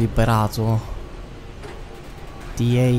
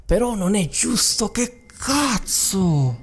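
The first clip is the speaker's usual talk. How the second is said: whining much higher, dragged out; high whiny protest; 10 semitones higher, slower than usual. Real, not acted. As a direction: stretch the complaint, high and slow. Killed again, protesting.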